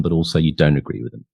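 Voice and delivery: usual speaking voice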